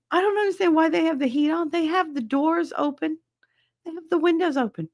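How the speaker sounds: high-pitched, baffled complaint; exasperated. Real, not acted. Ranting about heat on with doors open, exasperated. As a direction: complain up high, slowing on the list.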